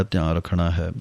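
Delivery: ordinary delivery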